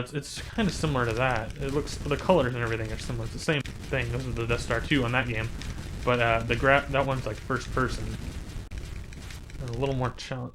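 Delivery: normal talking voice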